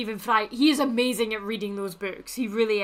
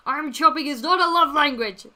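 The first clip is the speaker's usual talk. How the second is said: raised, high-pitched exclamation; loud protest; 6 semitones higher, 10 dB louder than usual. Real, not acted. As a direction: cry it out in protest.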